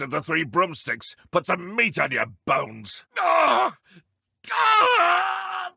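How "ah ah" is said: sharp high cry of pain. Acted. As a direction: yelp as the spell hits. Enemy hit by spell, crying out.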